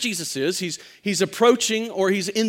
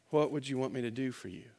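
gentle question; 7 semitones lower, 10 dB quieter, faster than usual. Acted. Jesus gently asks.

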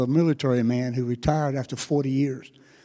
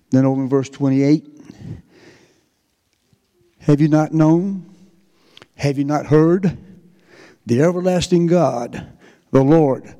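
full-voiced, louder, slow and measured reading of Isaiah; loud scripture reading; 6 dB louder than usual. Pastor reading Isaiah to his congregation, loud and proclaiming. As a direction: proclaim the verses to the whole church.

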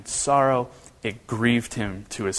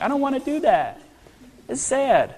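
very high-pitched, put-on sad voice; hammed-up pitying squeak; mock upset reaction; 11 semitones higher than usual. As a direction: play up being upset, pitched way up. Acting reaction to crying baby.

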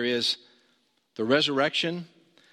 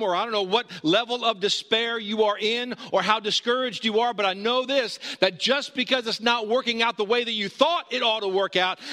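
higher-pitched, relentless sermon build; impassioned preaching; 6 semitones higher than usual. Real, not acted. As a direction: drive each phrase on without letting up. Preacher builds through a repeated list.

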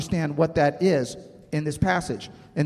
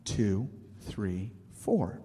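softer slow count; counting softly; 6 semitones lower, 9 dB quieter, much slower than usual. Retold or imitated. Preacher quoting the song's calming count, softly. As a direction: count down quietly to calm yourself. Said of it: "And then it goes".

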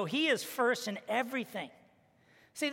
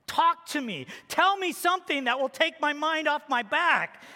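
higher, louder pleading voice of the hurt wife; 6 semitones higher, 5 dB louder than usual. Retold or imitated. Wife stuck on the floor begs her husband, higher and louder pleading. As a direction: plead to be distracted, a touch of panic.

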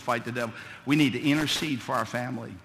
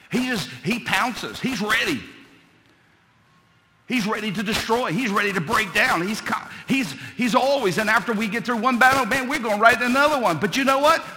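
rising louder and higher to full shout; preaching building to a shout; 10 semitones higher, 11 dB louder than usual. Acted. Preacher rallying mamas to keep fighting, building to shouting. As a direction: build from a fervent push to an all-out cry.

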